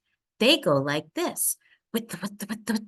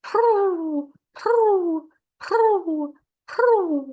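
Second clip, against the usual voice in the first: much higher, cooing purr; pigeon coo; 13 semitones higher, 5 dB louder, much slower than usual. A narrator's reading. Reading to a child, cooing like the park pigeons. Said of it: "They say".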